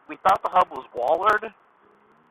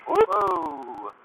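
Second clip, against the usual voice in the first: surprised; 13 semitones higher than usual. Real, not acted. Cacti suddenly in the road.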